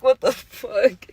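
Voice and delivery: drawn-out stunned question; slow disbelief. Retold or imitated. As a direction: let each word hang in disbelief. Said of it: "I'd be like".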